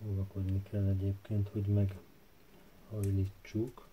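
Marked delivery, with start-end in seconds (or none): none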